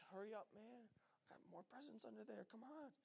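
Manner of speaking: hushed, impatient urging; impatient muttering. Acted. Kid waiting on brother and sister, impatient undertone. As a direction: mutter it under your breath, itching for your turn.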